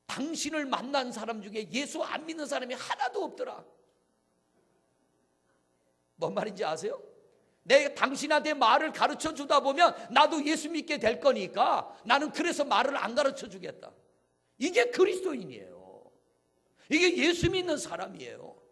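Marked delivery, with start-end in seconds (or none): 0.1-3.7 high, loud retold protest; preacher's impassioned retelling of a refusal; villager's vehement retort. Villager's refusal retold at full pitch. 7.6-18.6 high, booming sermon cry; impassioned pulpit shout; full-force preaching. Real, not acted.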